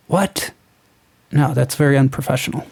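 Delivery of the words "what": big high gasp, then a hushed "What?"; mock shocked gasp. Mocking a lawyer shocked by a client's confession, gasping in mock horror. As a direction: act out a scandalized gasp.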